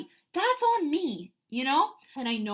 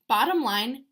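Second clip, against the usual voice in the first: ordinary talk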